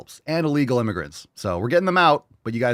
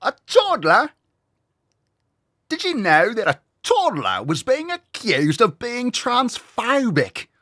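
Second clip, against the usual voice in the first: put-on British accent, gobsmacked; 10 semitones higher than usual. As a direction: play a gobsmacked Londoner. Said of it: "making fun of the accent".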